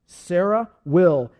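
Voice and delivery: loud, slow, emphatic; emphatic divine declaration. Acted. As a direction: press each word slowly and firmly. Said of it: "The Lord says".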